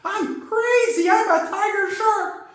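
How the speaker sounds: high, slow hyped-up fighter voice; a tiny brawler talking trash; Timmy's voice. Acted.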